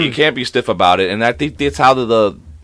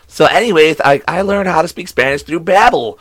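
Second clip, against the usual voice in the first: higher-pitched chirpy ad presenter; 6 semitones higher than usual. Retold or imitated.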